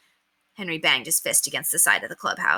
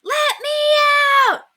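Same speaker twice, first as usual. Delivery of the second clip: slow, high, loud shout; drawn-out loud high shout; yell; 6 dB louder, much slower than usual. A narrator's reading. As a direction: pound on the door and holler. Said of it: "he yelled, pounding on the door".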